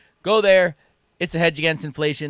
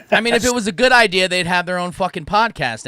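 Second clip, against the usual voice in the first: excited; 6 semitones higher, 8 dB louder than usual. Real, not acted. Teasing joke about viewers' ideas.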